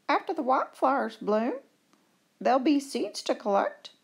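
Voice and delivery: high, small squeaky voice; little dormouse voice. Acted. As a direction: chirp it like a tiny mouse.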